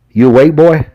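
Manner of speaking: a hint of a mocking sheriff waking a beaten suspect; gruff sheriff. A narrator's reading. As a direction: jab the question at him like a rough cop. Sheriff questioning the prisoner.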